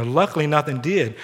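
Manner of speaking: ordinary talk, nothing marked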